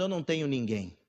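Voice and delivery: lightly acted victim, low and soft; understated woe-is-me, pitched well down; mild self-pity. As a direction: play the victim quietly, in a low voice. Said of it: "nos vitimizamos, dizendo".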